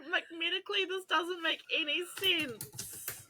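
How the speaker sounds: high, incredulous complaining, voice cracking; exasperated rant. Retold or imitated. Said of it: "I sat there going".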